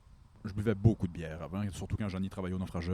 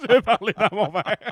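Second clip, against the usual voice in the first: laughing; 11 semitones higher, 7 dB louder than usual. Real, not acted. Laughing at own slip.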